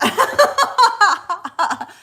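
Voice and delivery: high, loud burst of laughter. Real, not acted. Streamer laughing at her smoky incense, loud. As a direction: crack up out loud.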